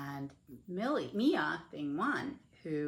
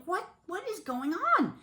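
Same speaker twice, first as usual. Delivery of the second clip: high-pitched, urgent questioning; urgent demand; 13 semitones higher, 4 dB louder than usual. Retold or imitated. Wife to husband as he comes to, urgent demand. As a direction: demand an answer, voice shooting up.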